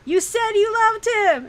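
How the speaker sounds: loud, high, slow mock yell; hammed-up high yell, drawn out; Amy yelling. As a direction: yell it out like an accusing friend. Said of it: "you hear Amy yell".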